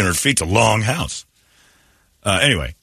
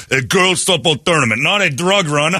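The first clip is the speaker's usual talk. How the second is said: higher-pitched mock coach, barely holding it together; straight-faced cartel coach; 8 semitones higher than usual. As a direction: insist on the cover story, close to laughing. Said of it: "I have to say with a straight face".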